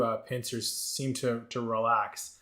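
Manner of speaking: usual speaking voice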